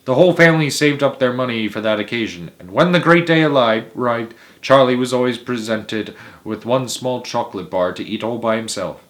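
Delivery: usual speaking voice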